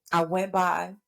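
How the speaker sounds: ordinary talk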